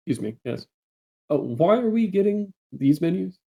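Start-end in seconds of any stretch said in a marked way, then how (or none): 1.3-3.4 high, questioning tone; mock-innocent high-pitched question; acted puzzled surprise. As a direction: query it with pitch lifted well up. Character questions the waitress.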